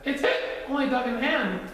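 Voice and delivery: high-pitched cub; high, hiccupy little bear; Little Bear character voice. A narrator's reading.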